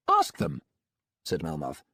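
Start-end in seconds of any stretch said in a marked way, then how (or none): 0.1-0.6 higher, smooth, faster doctor; breezy, untroubled villain doctor. A narrator's reading. Melmoth dismissive.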